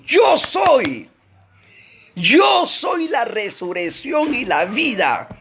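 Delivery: high, emphatic shout, drawn out at the end; ringing preacher's proclamation of Jesus's words; proclaiming as Jesus. Acted. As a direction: declare it with full authority, pitch leaping up. Voicing Jesus declaring to Martha.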